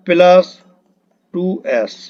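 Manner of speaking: ordinary talk, nothing marked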